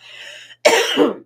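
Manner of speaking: short, loud bursts of laughter; amused laugh; real laugh. Real, not acted. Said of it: "like she's being sent to the corner".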